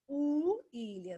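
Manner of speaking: William very slow, starting with a U vowel sound. Shown as a demonstration. Teacher showing students the W sounds like U. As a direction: stretch William out, begin with a U. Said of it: "aqui ele tem som de vogal U".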